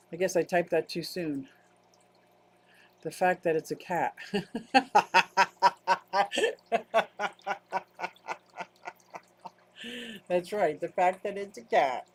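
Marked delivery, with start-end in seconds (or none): 4.2-8.3 prolonged rapid ha-ha laughter; long laugh. Real, not acted. Amused that it's a cat, long hearty laugh. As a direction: laugh on and on in a steady run.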